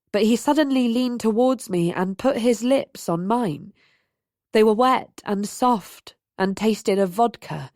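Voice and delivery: ordinary delivery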